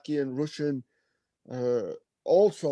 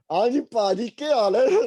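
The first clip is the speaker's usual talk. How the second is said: high, loud, hammed-up Punjabi bro hello; cheeky desi greeting; 9 semitones higher, 5 dB louder, slower than usual. A Brit who just spoke posh English greets the Punjabi men as a loud, cheeky paaji. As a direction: greet them like a long-lost cousin, grinning.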